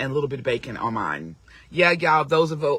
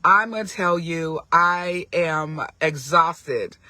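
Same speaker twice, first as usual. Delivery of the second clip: ordinary delivery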